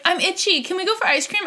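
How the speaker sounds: put-on kid voice. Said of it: "then she was like".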